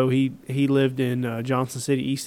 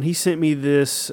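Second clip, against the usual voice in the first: ordinary delivery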